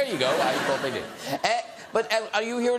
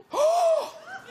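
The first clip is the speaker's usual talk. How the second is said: big high theatrical gasp; mock gasp. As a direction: gasp as if the news is scandalous. Hearing the posh London address.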